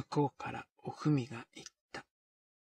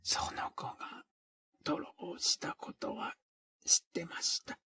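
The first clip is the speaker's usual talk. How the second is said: slow, weary, half-whispered; listless and slow; 6 semitones higher than usual. Acted. O-fumi slowly admits to the women, listless. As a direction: drag the words out, exhausted. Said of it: "だるいような口ぶりでゆっくりと言った".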